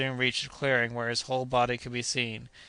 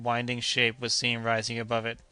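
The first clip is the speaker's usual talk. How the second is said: ordinary delivery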